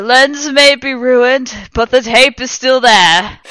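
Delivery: very slow, loud, high gloating; triumphant. A narrator's reading. Lupin gloating over the tape, slow, loud and high. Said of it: "held up the camera triumphantly".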